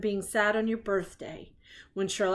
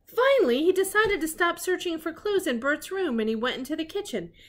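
plain reading voice

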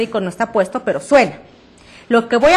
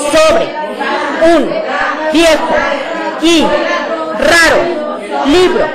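every word launched loud and higher, tonic syllable hit; loud projection drill, word by word; word-by-word projected reading; 4 semitones higher, 5 dB louder than usual. Shown as a demonstration. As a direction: read the poem one forceful word at a time. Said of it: "Voy a tomar cada palabra del texto".

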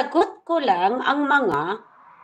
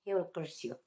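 quiet; 5 semitones lower, 16 dB quieter than usual. Real, not acted. Repeated warning, dropped.

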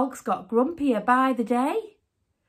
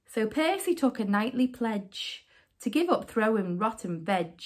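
ordinary delivery, nothing marked

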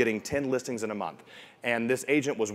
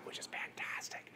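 whisper; 19 dB quieter than usual. Real, not acted. Aside about the CGI tools.

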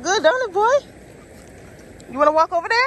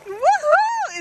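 high whooping cheer; excited cheer; 13 semitones higher than usual. Real, not acted. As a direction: cheer him on joyfully. Cheering dog.

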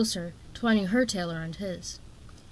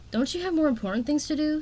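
a sharp, higher demand; 7 semitones higher than usual. A narrator's reading. Young dragon demands of the queen, sharply raised. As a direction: push back at the queen. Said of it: "Tsunami demanded".

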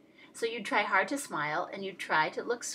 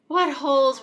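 ordinary delivery, nothing marked

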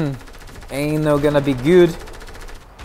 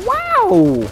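very high squealed wow; falsetto amazement. Real, not acted. Player reacts to game surprise, falsetto amazement. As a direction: shoot far up into falsetto.

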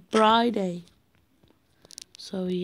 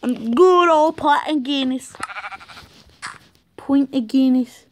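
louder, higher mock Irish accent; 3 semitones higher, 4 dB louder than usual. Gamer craving a Guinness, in a hammed-up Irish accent. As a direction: toast a pint in a put-on Irish accent. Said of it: "I don't have a good Irish accent".

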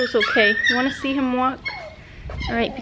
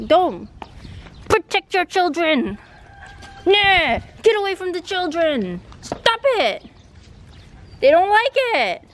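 angry yelling; 4 semitones higher than usual. Real, not acted. Owner shouting at the dog to leave the puppies alone. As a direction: drive the dog away from the puppies.

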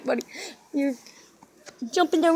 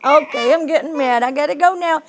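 usual speaking voice

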